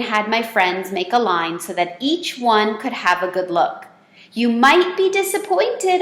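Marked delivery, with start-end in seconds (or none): 4.3-6.0 high squeaky hamster voice; squeaked. A narrator's reading. Hamster warning the classmates, a high squeak. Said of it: "I squeaked".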